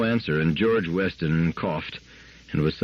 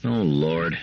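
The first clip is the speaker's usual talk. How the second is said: plain reading voice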